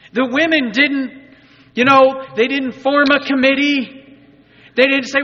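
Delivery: high, loud, emphatic preaching; loud preacher's proclamation, pitched high; preaching at full force. Real, not acted. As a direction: proclaim it to the whole congregation.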